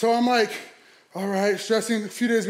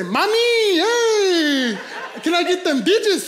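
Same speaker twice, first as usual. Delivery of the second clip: high, loud, drawn-out holler; mock catcall; 10 semitones higher, 5 dB louder, much slower than usual.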